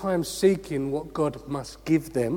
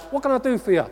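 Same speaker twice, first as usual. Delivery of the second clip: high-pitched, eager genie at your service; mock genie voice, higher than usual; 8 semitones higher, 3 dB louder, much faster than usual. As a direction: pop out and offer a wish, pitched well up. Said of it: "His name's Jehovah Genie".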